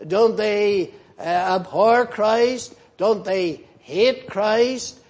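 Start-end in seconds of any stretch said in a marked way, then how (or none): none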